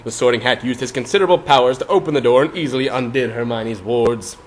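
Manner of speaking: ordinary delivery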